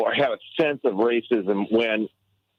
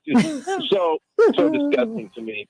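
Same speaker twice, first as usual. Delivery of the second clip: disgusted; 14 semitones higher, slower than usual. Real, not acted. Outraged at racist remark.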